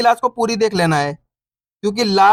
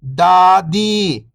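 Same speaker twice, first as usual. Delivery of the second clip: whole word, level then falling at the end; syllables blended; 2 semitones lower than usual. Shown as a demonstration. Teacher showing beginners the joined word, syllables blended. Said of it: "तो मिलके बन जाएगा".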